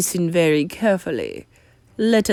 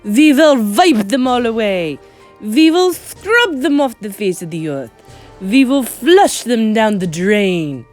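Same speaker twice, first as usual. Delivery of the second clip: loud, raised witch rant; forceful screamed rallying cry; 4 semitones higher, 7 dB louder than usual. A narrator's reading. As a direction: rail at a crowd, loud and high. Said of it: "screamed the Grand High Witch".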